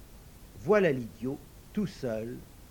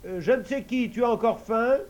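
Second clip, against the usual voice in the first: higher, louder unsure call; hesitant idiot calling; 8 semitones higher, 7 dB louder than usual. Acted. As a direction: try the magic call timidly. Idiot asks servant.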